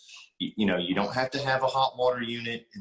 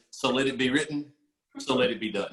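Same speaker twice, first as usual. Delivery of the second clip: ordinary talk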